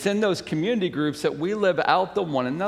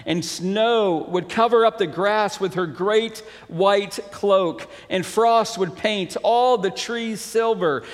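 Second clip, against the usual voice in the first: higher, animated storybook reading; story narration; 5 semitones higher, 4 dB louder than usual. A narrator's reading. Pastor reading The Selfish Giant to the congregation, lifted story narration. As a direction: read it out with lifted, emphatic storyteller energy.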